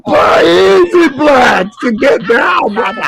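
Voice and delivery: high wailing shout; hammed-up tearful plea; mock crying shout.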